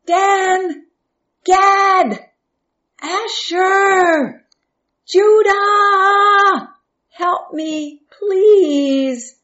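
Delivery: high, long-drawn calls of names; shouting for help. A narrator's reading. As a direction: holler each name from far below, pleading. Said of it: "Joseph was still shouting".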